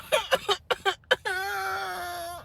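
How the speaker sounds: high fake crying wail; mock kid wail. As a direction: imitate the boy bawling, high. Imitating Roger crying.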